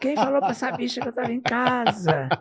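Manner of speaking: retelling a diva yelling she won't come; mock yell from inside. Friend imitating Elis screaming from inside the house, mock yell. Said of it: "ela começou a gritar lá dentro".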